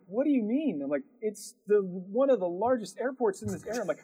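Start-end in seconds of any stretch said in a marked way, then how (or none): 0.1-0.8 higher, panicked disbelief. Retold or imitated. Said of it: "I was, like, in a panic". 1.2-3.8 panicked. Retold or imitated. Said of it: "in a panic".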